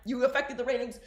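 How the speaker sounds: normal talking voice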